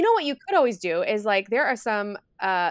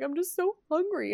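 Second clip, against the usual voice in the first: tearful; 9 semitones higher than usual. Retold or imitated. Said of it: "she looked at me in tears".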